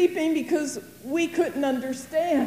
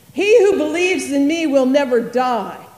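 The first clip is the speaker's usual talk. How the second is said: loud, solemn proclamation of Jesus; proclaiming loudly; 9 dB louder than usual. Retold or imitated. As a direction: declare it boldly, much louder. Jesus proclaiming to grieving Martha.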